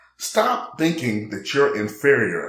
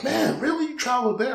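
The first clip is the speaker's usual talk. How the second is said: mock disbelief; 13 semitones higher than usual. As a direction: voice jumps much higher in a mock incredulous question. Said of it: "He was like".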